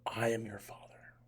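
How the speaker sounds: slow, whispered villain impression; Darth Vader. Darth Vader turns and says to Luke, slow whisper. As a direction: whisper it slowly, like a menacing masked villain. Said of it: "Darth Vader turns".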